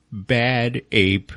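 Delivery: lightly voiced chimp name, with a laugh; a hint of a simple ape saying its name. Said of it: "he goes".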